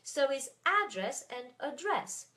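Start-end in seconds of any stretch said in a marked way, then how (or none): 0.6-1.2 ADdress, stressed on AD; stress on first syllable. Shown as a demonstration. French teacher contrasting two English words, first-syllable stress on address. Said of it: "Address has the accent in the beginning". 1.6-2.3 adDRESS, stressed on DRESS; stress on last syllable. Shown as a demonstration. French teacher contrasting two English words, end stress on address. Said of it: "Address is stressed at the end".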